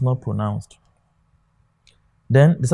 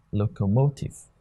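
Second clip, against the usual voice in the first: locomotive with the weight on MO; stress on third syllable; 3 semitones lower, 5 dB quieter than usual. Shown as a demonstration. Teacher repeating locomotive for students, stress on the third syllable MO. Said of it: "It is on this".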